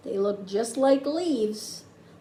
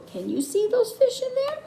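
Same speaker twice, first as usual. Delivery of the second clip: excited question; 10 semitones higher than usual. Real, not acted. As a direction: ask the little ones brightly. Asks the children, pointing.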